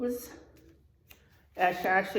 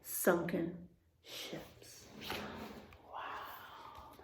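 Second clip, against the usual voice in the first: fading to a breathless whisper; hushed awe; 5 semitones lower, 15 dB quieter, much slower than usual. Acted. Teacher reading about treasure, hushed awed whisper. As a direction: hush down in wonder at the treasure.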